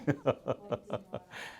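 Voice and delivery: soft, breathy run of laughter; rolling, hushed laugh; low chuckle. Real, not acted. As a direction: chuckle quietly at your own tease. Laughs after teasing the audience.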